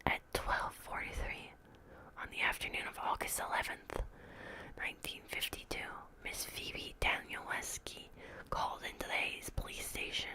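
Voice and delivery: plain reading voice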